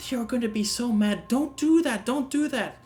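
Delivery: high, pleading little creature voice; straw figure pleading. Acted. Little straw figure begging the sorceress to stop, high and pleading. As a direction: squeak out a frantic plea.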